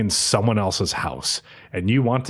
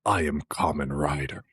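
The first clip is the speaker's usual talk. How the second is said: I am Kamen Rider said lower and softer, grave; dramatic hero line; 9 semitones lower, 6 dB quieter than usual. Retold or imitated. Tojima answering Emily, a hint of brooding hero. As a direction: drop the voice low for I am Kamen Rider. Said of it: "And he says".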